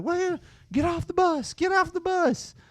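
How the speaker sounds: high shrill bus driver; panicked bus driver. Retold or imitated. Driver voiced, freaking out.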